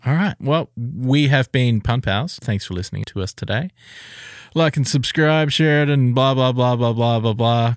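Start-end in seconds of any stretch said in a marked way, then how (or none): 4.5-7.8 dismissive outro. Acted. Podcast outro sign-off.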